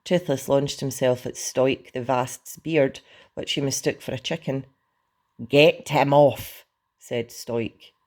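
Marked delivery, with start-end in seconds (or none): 5.5-6.6 loud, slow, heavy order; commanding shout. A narrator's reading. Stoic orders dragon off his beard, loud command. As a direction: bark it loudly, each word drawn out.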